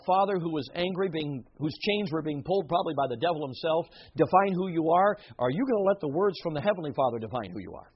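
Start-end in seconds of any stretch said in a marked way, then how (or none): none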